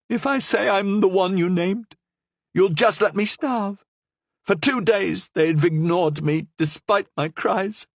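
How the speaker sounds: high, pleading prisoner voice; desperate prisoner. A narrator's reading. Starved prisoner begging the Cardinal, high and desperate. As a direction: plead from the tomb.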